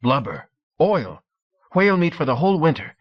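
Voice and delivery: he can't believe they don't know; young Norseman explaining the whale hunt with relish; incredulous then excited. A narrator's reading. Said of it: "unable to believe his ears".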